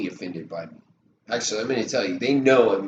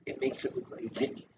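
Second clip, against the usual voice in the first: whisper; 10 dB quieter, faster than usual. Real, not acted. Whispered aside during demo.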